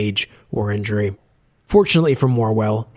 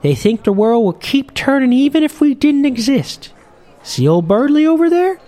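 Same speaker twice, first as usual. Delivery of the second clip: put-on much higher, louder old sailor voice; a chatty old sea captain, pitched way up; old fisherman; 14 semitones higher, 5 dB louder than usual. Old captain grumbling to Morwell at the bar, in a high loud old-man voice.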